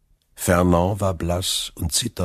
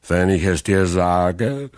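slow, louder tipsy fisherman's insistence; drunken Caderousse; 6 dB louder than usual. Acted. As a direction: lean in like a loud drunk. Drunk Caderousse holds forth.

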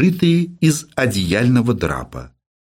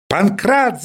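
shouting into receiver; 8 semitones higher than usual. Acted. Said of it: "закричал в трубку профессор".

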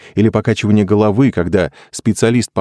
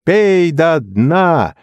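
high, slow drawn-out chant; crowd yell; 11 semitones higher, much slower than usual. A narrator's reading. Friends urging the drinker on, drawn-out yell. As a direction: egg them on with a stretched cry. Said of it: "завопили все".

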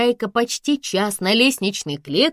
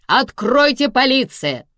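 loud, raised police command; stern bark; 6 semitones higher, 5 dB louder than usual. A narrator's reading. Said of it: "рявкнули строго".

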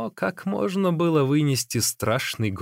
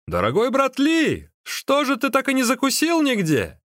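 high, raised-voice call to a companion; 12 semitones higher, 3 dB louder than usual. A narrator's reading. Magician hails the running man as he catches up, calling out. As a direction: shout it across to him, pitched way up. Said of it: "и крикнул ему".

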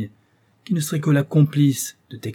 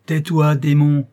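angry shout; 3 semitones higher, 6 dB louder, slower than usual. Acted. Victor fait taire le monstre.

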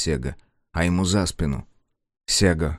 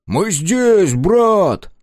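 calling out; 12 semitones higher, 8 dB louder, much slower than usual. A narrator's reading. Friends calling from the crowd.

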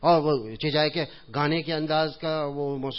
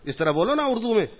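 higher, louder scolding; mock conductor scolding; 6 semitones higher, 5 dB louder than usual. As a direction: rub it in. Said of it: "تو conductor نے پھر". (طنزیہ conductor کی ڈانٹ)

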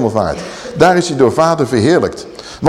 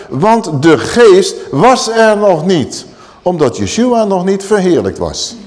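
slow emphasis; 4 semitones higher, slower than usual. Acted. Slow emphatic scripture reading.

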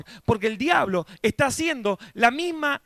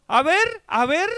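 mocking taunt; 4 semitones higher than usual. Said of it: "no como diciendo".

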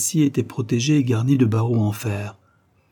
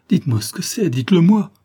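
frantic plea; 7 semitones higher, 4 dB louder than usual. Acted. Narrator begs the nurse frantically.

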